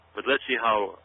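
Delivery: ordinary talk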